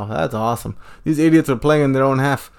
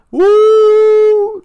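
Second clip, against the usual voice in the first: high loud cheer; 8 dB louder than usual. Real, not acted. Goal celebration cheer.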